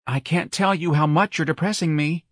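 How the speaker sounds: high-voiced dramatic complaint; fretting young man. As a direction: fret theatrically about your nerves.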